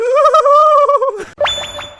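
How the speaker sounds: put-on long high-pitched drunk squeal; high squealing whoop.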